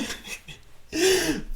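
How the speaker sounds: high burst of laughter. Real, not acted. Laugh after own quote.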